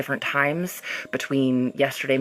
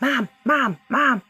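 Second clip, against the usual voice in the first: put-on higher, insistent child calling; whiny kid; 11 semitones higher, slower than usual. A kid pestering a worn-out mom, high insistent calling. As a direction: nag like a kid who wants attention. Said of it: "even though they're like".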